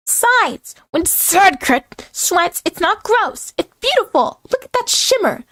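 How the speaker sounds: very high, proud character voice; hammed-up high voice, self-admiring; boastful high-pitched character. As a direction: gush about yourself way up high.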